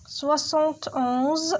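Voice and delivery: very slow, spaced reading; slow teacher read-aloud; slow dictation. Shown as a demonstration. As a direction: read it out slowly for pupils. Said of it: "teacher reads slowly".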